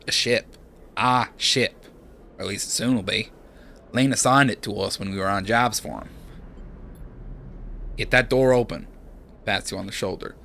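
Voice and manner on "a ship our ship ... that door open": put-on a touch of irritation in an Australian accent; mildly annoyed Aussie explaining; lightly voiced annoyed Australian. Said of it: "Seraphin looks annoyed".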